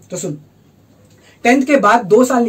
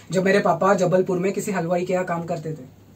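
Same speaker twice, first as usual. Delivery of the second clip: ordinary talk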